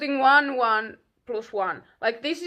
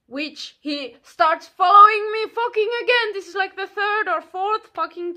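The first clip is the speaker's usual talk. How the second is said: outraged; 7 semitones higher, 6 dB louder than usual. Real, not acted. Outraged retelling.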